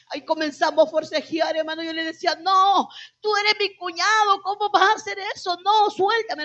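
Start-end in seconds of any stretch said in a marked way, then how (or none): 2.4-6.4 pleading higher and louder, pushing him away; desperate pleading. Retold or imitated. Woman pleading with her brother-in-law, a desperate raised plea. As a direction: beg him to let go, voice rising in panic.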